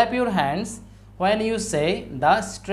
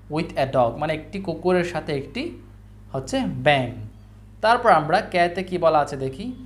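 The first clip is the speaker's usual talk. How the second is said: ordinary talk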